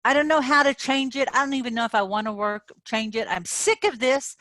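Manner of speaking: high, loud, fed-up ranting; acted frustration. Coach acting out a frustrated rant for her viewers, high and loud. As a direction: complain like you've had enough. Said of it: "I'm acting".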